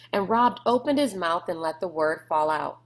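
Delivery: usual speaking voice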